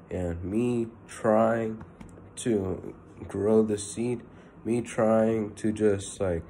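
drawn out slowly, leaning on trying; slow it right down and press each word; slow emphatic stress. Real, not acted. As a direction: spell out the pointlessness slowly. Stressing his own impatient efforts.

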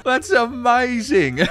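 real laughter breaking through; laughing. Real, not acted. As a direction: crack up at the line. Bursts out laughing at message.

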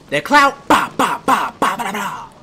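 loud, high ba-ba gun noises; mock gunfire. Gamer shooting at clouds, loud mock gunfire. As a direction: blast out mock gunshots with your voice.

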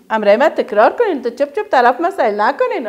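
ordinary talk

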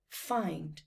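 usual speaking voice

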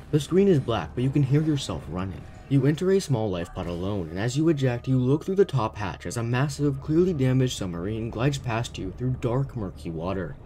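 normal talking voice

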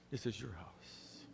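"This is your house" soft, low and slow; tender father. Acted. Father tenderly reassuring son.

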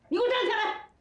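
high-pitched angry order; angry command. Acted. Friend confronts the husband who bullied his wife, angry command. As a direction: order him up, voice jumping high.

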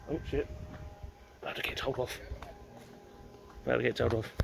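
very soft, furtive undertone; sneaking, hushed. Real, not acted. Sneaking past no entry, hushed. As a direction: keep it down so nobody catches you.

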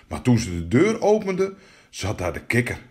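usual speaking voice

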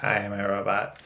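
a hint of a robot; lightly robotic, flat. Partner echoes the joke, lightly robotic. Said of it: "we do that too".

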